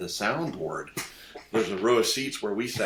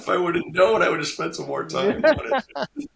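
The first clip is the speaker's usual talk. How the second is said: high, laughing oh no; laughing dismay; 9 semitones higher, 7 dB louder than usual. Retold or imitated. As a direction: groan at yourself through laughter. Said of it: "And I'm going".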